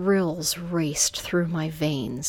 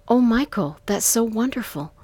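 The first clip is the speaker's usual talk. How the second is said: raised, delighted phone voice; sounding sure and glad; 5 semitones higher, 5 dB louder than usual. A narrator's reading. Woman covering her doubts to Michael, sounding glad. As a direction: say it as if truly thrilled. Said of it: "sounded a lot more sure".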